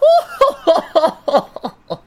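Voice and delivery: high, loud gloating cackle; evil villain laugh. Pretend. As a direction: laugh like a tyrant. Villain laugh after Frieza line.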